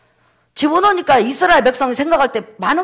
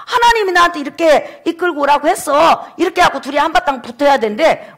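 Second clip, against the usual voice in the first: combative retort; 3 semitones higher than usual. Acted. Moses snapping back at the rebellious people, combative. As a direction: square up and argue back, voice often raised.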